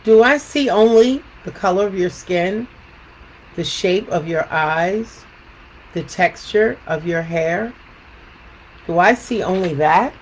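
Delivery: measured storytelling with lilting questions; picture book read-aloud. Acted. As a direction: read to children, pausing after each thought.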